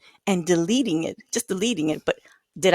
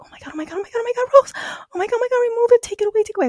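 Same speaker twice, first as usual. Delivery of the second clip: high-pitched panicked rush of pleading; imitating a friend freaking out; frantic friend; 3 dB louder, much faster than usual. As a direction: beg in a flustered, high mock panic. Retold friend's frantic phone call.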